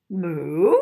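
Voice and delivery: low moo swooping up, loud; cow moo. Acted. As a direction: moo like a cow, sliding upward. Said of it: "So the cow said".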